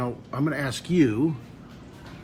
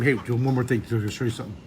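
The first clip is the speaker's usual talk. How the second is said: ordinary delivery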